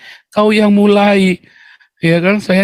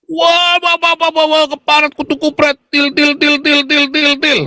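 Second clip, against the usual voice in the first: high, rapid-fire babble of abuse; mock sputtering rage; 10 semitones higher than usual. Opponents abuse him after his question, high mock sputtering. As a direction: parody an opponent spluttering into repeated nonsense syllables.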